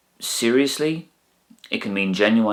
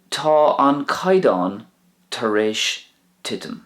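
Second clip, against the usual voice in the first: "Tá an caidán tar éis titim" said slowly, first word raised high; slow careful model; 4 semitones higher than usual. Shown as a demonstration. Teacher modelling the Irish sentence for learners, slow and careful. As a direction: spell out "Tá an caidán" slowly, lifting "Tá". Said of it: "The quality has decreased".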